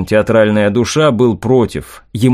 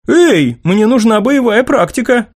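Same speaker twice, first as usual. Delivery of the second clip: put-on girl voice; 11 semitones higher than usual. Irene protesting loudly.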